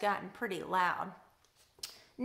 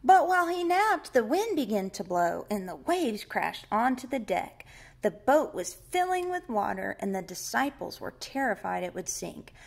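louder, expressive storybook narration; projected Bible-story telling; children's story reading; 5 dB louder than usual. A narrator's reading. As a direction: read it aloud dramatically to children.

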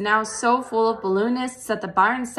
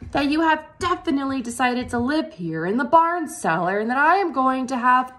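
Wilbur asking; 5 semitones higher, 3 dB louder than usual. A narrator's reading. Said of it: "asked Wilbur".

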